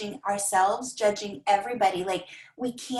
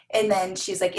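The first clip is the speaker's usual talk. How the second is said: ordinary delivery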